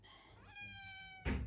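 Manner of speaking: very high, slow, soft squeak; squeaky baby voice. Acted. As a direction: talk to the cat like a tiny toy. Squeaky voice to the cat.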